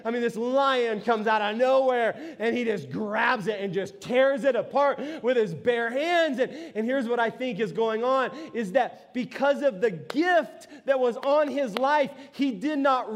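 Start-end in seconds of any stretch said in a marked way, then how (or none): none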